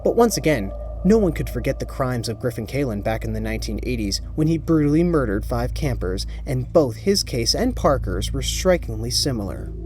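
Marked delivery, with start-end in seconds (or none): none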